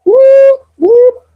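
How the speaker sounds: very high, loud whoop whoop; loud celebratory whoop; whooping cheer. Real, not acted. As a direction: let out a victory whoop. Celebrating hearts.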